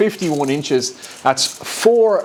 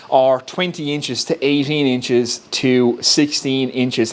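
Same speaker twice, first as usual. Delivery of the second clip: ordinary delivery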